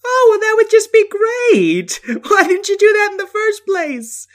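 high loud mock relief; sarcastic. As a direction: gush with exaggerated sarcasm.

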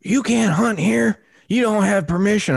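very high, scolding yell; imitated angry hollering. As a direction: mimic a woman yelling objections from afar. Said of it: "she was mother effing me up and down".

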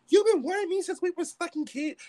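very high, loud retold outburst; reenacting an angry, upset shriek; crying pissed. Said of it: "crying pissed".

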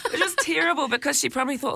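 real laughter, fast and lifted; laughing while talking. Real, not acted. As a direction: laugh at her own embarrassment. Laughing while retelling swearing at the scan.

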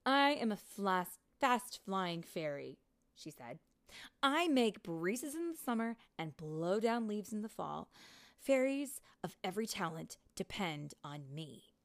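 a hint of a snooty rival; proud and dismissive; haughty fast fairy. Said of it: "Vidia frowned".

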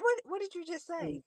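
taken aback. Retold or imitated. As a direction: ask it quietly, thrown by what you heard. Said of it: "they be like".

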